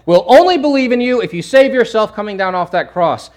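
loud, high taunt; acted heckler calling out; mocking challenge.

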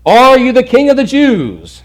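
loud, high-pitched interrogating official; demanding Pilate. Acted. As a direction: put the question loudly, like a Roman governor. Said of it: "he asks him in verse two".

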